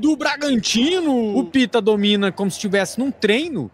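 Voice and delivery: fast radio match call; live play-by-play. Acted. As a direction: narrate the attack live.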